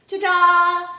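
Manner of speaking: loud, high, held fanfare; triumphant reveal. A narrator's reading. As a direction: present it like a big surprise. Girl triumphantly presents her find.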